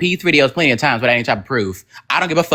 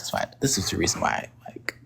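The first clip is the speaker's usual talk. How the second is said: whispered laugh; 9 semitones lower, 11 dB quieter than usual. Real, not acted. Laughs breaking up.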